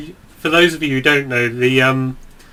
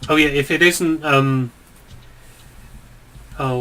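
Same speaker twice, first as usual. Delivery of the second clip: usual speaking voice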